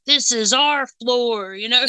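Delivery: slow, high mock ghost claiming his floor; a lightly voiced grumpy farmer ghost guarding his turf; territorial spirit. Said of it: "he's like".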